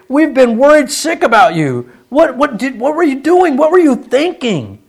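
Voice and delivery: mock-frantic mother scolding her boy; distraught mother. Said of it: "That's basically what she said".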